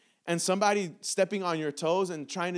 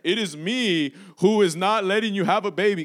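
deep booming Genie voice; 7 dB louder than usual. Acted. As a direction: speak as a big deep Genie. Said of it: "I'm sounding all deep, you know, I'm sounding like Genie".

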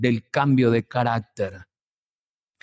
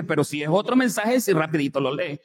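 ordinary talk, nothing marked

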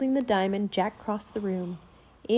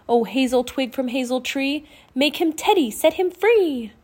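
chanted rhyme building higher and louder; spell incantation; 4 semitones higher, 5 dB louder than usual. A narrator's reading. Annie waving the wand over Teddy's cage, rising incantation. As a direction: build the spell to a ringing finish.

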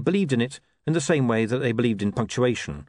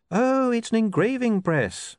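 higher, slow and distracted; vaguely; 13 semitones higher, slower than usual. A narrator's reading. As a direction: say it absent-mindedly, drawn out. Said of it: "said William vaguely".

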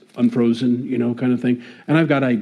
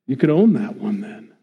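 ordinary delivery

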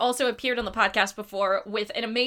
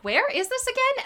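confused; 13 semitones higher than usual. Retold or imitated. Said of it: "I'm like".

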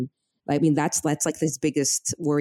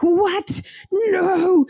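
mock freak-out; 12 semitones higher, much slower than usual. Husband retelling his wife's shock, high mock yell. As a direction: play the husband losing it. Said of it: "He's, like, going crazy".

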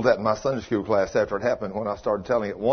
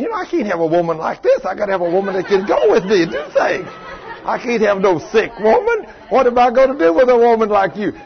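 mock scolding; 11 semitones higher, 8 dB louder than usual. Mock complaint about sick wife.

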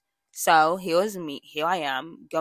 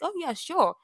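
bright, high, casual yes; high breezy agreement; 11 semitones higher than usual. Retold or imitated. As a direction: toss off a cheerful sure. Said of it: "I was like".

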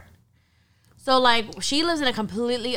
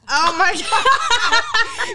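laughing; 12 semitones higher, 6 dB louder, much slower than usual. Real, not acted. Friend reacting to the story.

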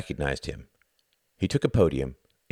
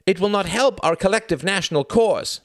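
desperate cry; 6 dB louder than usual. A narrator's reading. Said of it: "cried the man in slightly more genuine desperation".

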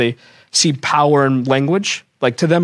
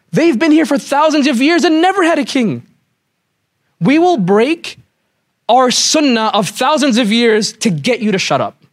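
exasperated offer; 7 semitones higher than usual. Retold or imitated. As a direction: fling the offer out in frustration. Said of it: "he gets a tantrum".

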